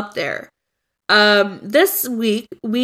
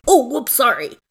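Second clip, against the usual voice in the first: put-on Canadian accent; 3 semitones higher than usual. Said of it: "My Canadian accent's garbage".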